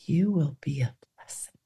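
soft whispered blessing. Pretend. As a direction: whisper it gently, eyes closed. Guided meditation, God's voice.

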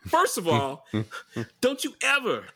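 half-laughing mock outrage; mock tough guy. Mock confronting the neighbor.

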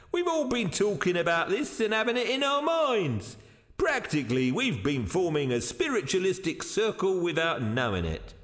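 high-voiced, earnest theorizing; earnest explainer. One of the men explaining the apparition, earnest. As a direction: explain eagerly, starting high and settling.

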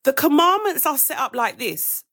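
normal talking voice

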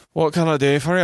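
put-on high, botched Birmingham accent coming out Scottish; bad Birmingham accent. Hotel woman greeting a guest, failed Birmingham accent. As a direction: try Birmingham, land on Scottish. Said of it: "I can't do the Birmingham accent. That was just Scottish".

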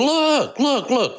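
sudden high excited "look, look"; urgent cry. Retold or imitated. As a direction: grab the king's attention in alarm. Eunuch urgently alerting the king.

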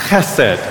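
Chesed with a throaty ch, then sed; Hebrew pronunciation. Shown as a demonstration. Pastor saying the word with the congregation, throaty ch. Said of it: "One more time".

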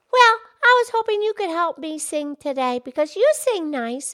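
ordinary delivery

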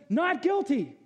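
high, firm pronouncement of the verdict; God's verdict. Retold or imitated. God acquitting the sinner, firm verdict. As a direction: declare Not guilty like a judge. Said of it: "And he says".

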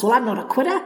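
normal talking voice

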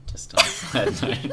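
words squeezed out through a high laugh; laughing aside. Real, not acted. Laughing while teasing his friend about finally beating him.

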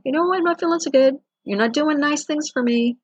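put-on high, whiny little-baby plea; voicing the body as a whimpering baby. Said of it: "It may start whimpering and going".

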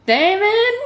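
high, rising call of the name; questioning call. Acted. Reader voicing Laurent to Damon, high questioning call.

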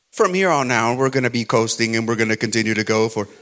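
low, calm captain on the intercom; pilot announcement. Acted. Pilot telling passengers the ride is smooth, calm announcement.

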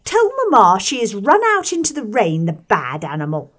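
high, loud bratty calling out; whining schoolboy shout. Acted. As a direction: yell for his sisters, spoilt and whining. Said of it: "whining and nasal voice of John Reed".

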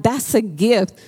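ordinary talk

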